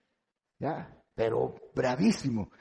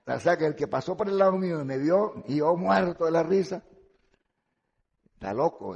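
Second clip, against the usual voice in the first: ordinary talk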